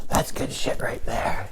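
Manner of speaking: slow, hushed near-whisper; cool made-up voice. Acted. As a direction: drop to a soft, drawn-out whisper. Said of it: "imagine that's what my voice sounded like".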